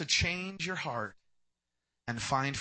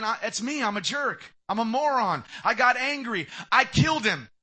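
louder and louder higher confession; confessing Cain; 7 semitones higher, 9 dB louder than usual. Retold or imitated. Cain confessing before God, building to a loud cry. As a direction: build from pleading to crying out. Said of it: "falling on his face and saying".

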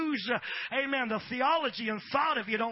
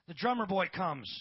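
ordinary delivery

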